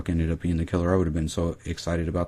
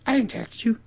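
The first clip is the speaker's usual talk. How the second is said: high falsetto denial; mock denying woman. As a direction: imitate her protesting, very high. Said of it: "She's like".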